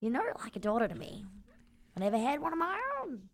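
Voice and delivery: soft fatherly admission; tender confession. Acted. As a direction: say it softly like a proud father.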